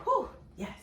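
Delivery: high, relieved yes; relieved whew. Retold or imitated. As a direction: breathe out relief. Relief on waking.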